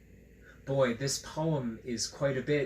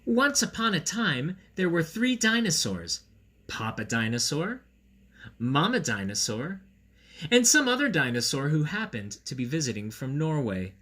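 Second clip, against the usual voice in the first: storybook narration; 3 semitones higher, 5 dB louder than usual. A narrator's reading. Begins reading the storybook.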